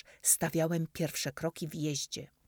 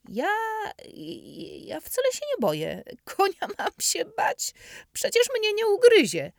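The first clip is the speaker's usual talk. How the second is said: stammered, much higher and louder fake bravado; boy pretending not to fear the horse, nervous bravado; 8 dB louder than usual. Boy tells his new friend he is not scared, shaky bravado. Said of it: "zrobiłem pewną minę".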